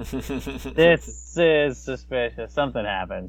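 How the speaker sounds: hammed-up wary voice, dropping deep; mock suspicion. Acts out wary gift recipient.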